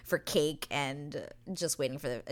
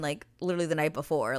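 normal talking voice

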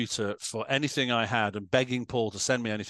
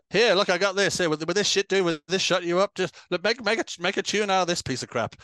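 raised, loud, brushing-off Paul voice; dismissive Paul; 7 semitones higher, 5 dB louder than usual. Retold or imitated. Paul fobbing off a bored bandmate, in a loud dismissive voice. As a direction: toss it at him to shut him up. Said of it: "he would just dangle".

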